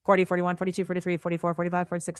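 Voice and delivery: breakneck, evenly run-on count; very fast counting. Shown as a demonstration. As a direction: rattle off the numbers at top speed.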